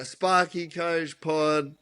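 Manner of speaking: high, very slow announcer attempt; mock show intro. Guest trying the podcast intro, hammed-up announcer. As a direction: drag the show's name out, pitched right up. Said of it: "do you wanna do the intro".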